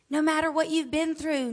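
ordinary talk, nothing marked

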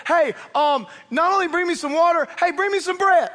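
hamming up a bossy Elijah; mock demanding prophet. Preacher acting Elijah ordering the widow, loud and pushy.

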